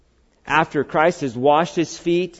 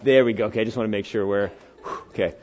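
normal talking voice

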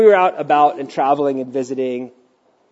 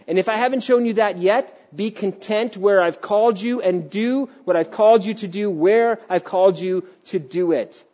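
usual speaking voice

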